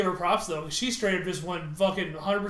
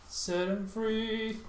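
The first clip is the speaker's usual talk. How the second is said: drawn out; 3 semitones higher, 4 dB quieter, much slower than usual. Shown as a demonstration. Repeats phrase, second drawn out.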